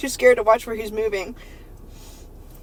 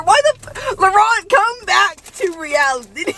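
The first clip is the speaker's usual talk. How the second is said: high, loud, slow pleading; exasperated plea; 8 semitones higher, 6 dB louder, slower than usual. Acted. Urging Laurent back to reality, loud and exasperated. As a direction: beg him to snap out of it.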